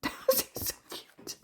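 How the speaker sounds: soft, voiceless squee; hushed adoring whisper; delighted whisper. Real, not acted. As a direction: whisper it through a little laugh. Cooing over cute items.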